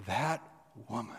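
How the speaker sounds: "That" lifted with a pause before "woman."; wondering that woman. Shown as a demonstration. Preacher showing an awed, broken reading. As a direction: rise on "That", pause, then "woman.". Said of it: "Or it could be like".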